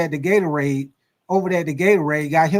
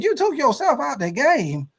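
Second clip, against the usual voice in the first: disbelieving; 7 semitones higher than usual. Retold or imitated. Teammate disbelieving.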